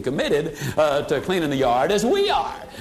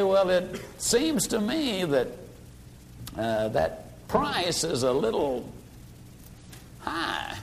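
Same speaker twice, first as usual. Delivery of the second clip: mock-shy customer who barely dares to object; timid, hesitant; 7 dB quieter, slower than usual. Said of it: "a little timid or a little hesitant".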